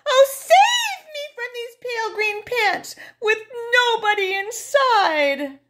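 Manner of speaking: high, loud terrified cry; desperate plea. Acted. Narrator pleading to be saved, high terrified voice. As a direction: beg for rescue in terror.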